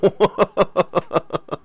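soft staccato chuckle; real laugh. Real, not acted. Host amused by the monk stunt, real chuckling laugh. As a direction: chuckle in quick, soft pulses. Laughs at his own bafflement.

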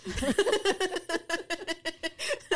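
high, loud burst of laughter. Real, not acted. Teller cracking up at the clerk's suspicion, loud laugh. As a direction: crack up mid-story.

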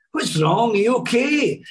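lightly imitated comedian checking on a friend, rising high; Billy Connolly. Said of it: "Billy looked over to him".

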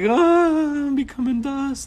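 high, slow, drawn-out mock cry; mock dying wail. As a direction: wail it playfully as if fading into dust. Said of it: "you're like".

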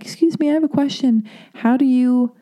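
high, sweet asking voice; high polite question voice. Retold or imitated. To the seminar leader on the microphone, mock polite high question.

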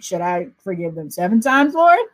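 sarcastic disciple. Retold or imitated. Disciple sarcastically asking Jesus about forgiveness, lightly mocking. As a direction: ask it with a hint of mock sarcasm. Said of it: "the disciple was being a little sarcastic".

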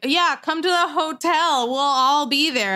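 high, drawn-out fake-friendly invitation; mean girl. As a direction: slowly lure them in, mock mean-girl style. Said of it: "like, a Mean Girls scene".

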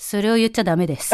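imitating a calm staffer, pitched low. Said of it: "すっごい低い声".

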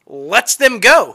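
slow, loud, drawn-out reveal; slow emphatic reveal. Real, not acted. Revealing the series twist to listeners, slow and emphatic. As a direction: land each word of the twist. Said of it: "picks friendship over country".

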